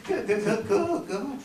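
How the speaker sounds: high, soft giggling through the words; nervous laughter. Acted. Newcomer caught in his own lie, giggling nervously. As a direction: giggle helplessly while squeezing words out.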